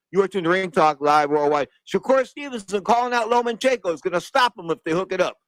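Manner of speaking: ordinary talk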